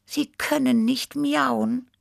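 story character. A narrator's reading. Cat figure in a read story.

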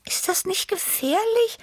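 very high, breathy cat voice; tiny high awestruck voice; breathed, timid question. A narrator's reading. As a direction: breathe it out high, worried by danger. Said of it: "hauchte Tibi".

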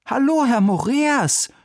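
put-on high, loud boyish hello; a young boy greeting his teacher; boy's greeting. (hohe, laute Jungenstimme)

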